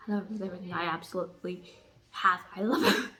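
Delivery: normal talking voice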